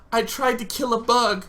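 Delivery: high, distressed admission; panicked confession. Retold or imitated. Bleeding son confessing to his mom, panicked confession.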